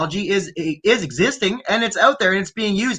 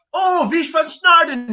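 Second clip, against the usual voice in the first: slow, higher mock German with a drawn-out "Oh"; mock German reply; 8 semitones higher than usual. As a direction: ham up a fake German answer. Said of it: "He'll be like".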